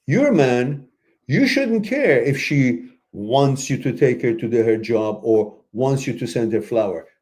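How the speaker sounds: ordinary talk, nothing marked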